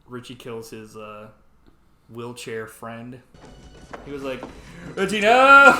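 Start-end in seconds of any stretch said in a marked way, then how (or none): 4.9-5.7 loud, high cry of protest; mock dramatic shout. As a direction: yell it like a hammy movie victim. Acts out horrified cry at killing.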